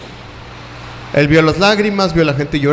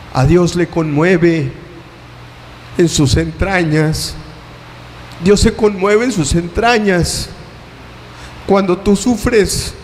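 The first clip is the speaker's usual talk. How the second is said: tender resolution; slower than usual. Acted. Preacher reassuring the hurting after the climax, slow and tender. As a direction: drop down and comfort them.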